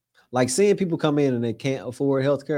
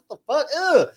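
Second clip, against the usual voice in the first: shocked disgust; 14 semitones higher, 3 dB louder than usual. Retold or imitated. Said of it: "I was like".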